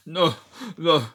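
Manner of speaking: hammed-up cries of bad movie acting; Sylvester Stallone. Rambo reacting to losing Co, hammed-up loud impression. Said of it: "acting there from Sylvester Stallone".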